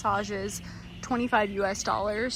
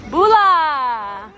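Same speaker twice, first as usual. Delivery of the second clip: louder, higher Bula called across to hosts; loud greeting; 7 semitones higher, 8 dB louder, much slower than usual. Real, not acted. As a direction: call out the greeting with joy.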